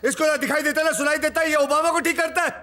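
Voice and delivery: angry shouting. Acted. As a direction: rant furiously about the useless doctor. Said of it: "Why are you shouting?".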